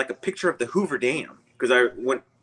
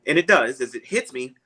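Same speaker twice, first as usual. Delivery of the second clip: ordinary delivery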